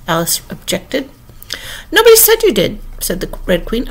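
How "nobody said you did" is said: high, louder retort; indignant retort. A narrator's reading. Red Queen answering Alice's objection, indignantly. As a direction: throw it back high and forceful.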